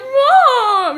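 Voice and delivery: loud, high-pitched mock-crying call; wailed plea. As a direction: cry out for mom in protest. Wails at mom on phone.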